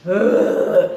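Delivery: loud breathless groan of disgust; loud disgusted ugh. Retold or imitated. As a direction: groan out your revulsion. Disgust as he returns.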